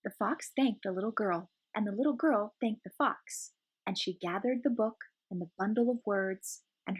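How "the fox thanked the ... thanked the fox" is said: plain reading voice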